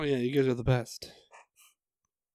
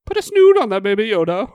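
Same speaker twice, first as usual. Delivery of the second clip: much higher, louder, giddy; high excited shout; 7 semitones higher, 9 dB louder than usual. Acted. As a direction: squeal it out with glee. Excited silly command.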